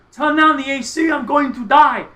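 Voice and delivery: loud, high-pitched mock complaint; imitated yell. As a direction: yell it dramatically, as if imitating a star. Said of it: "he yells to the sound guy".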